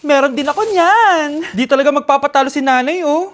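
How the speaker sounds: loud, boastful mother in an ad; proud mom. Acted. Mother bragging to the viewers in the ad, loud and proud. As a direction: brag like a mom who won't lose.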